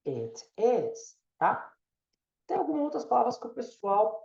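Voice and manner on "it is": "It is" with short, open i, nearly "et es"; low, careful model of "It is" with e-like vowels; native-like pronunciation. Shown as a demonstration. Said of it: "você vai trocar o I pelo E".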